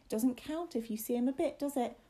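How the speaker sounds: put-on high young boy's voice; William, anxious. A narrator's reading.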